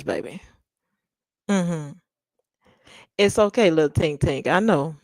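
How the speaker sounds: ordinary talk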